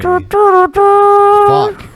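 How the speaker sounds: high, loud yelp of annoyance; frustrated outburst. Real, not acted. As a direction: blurt it out in frustration.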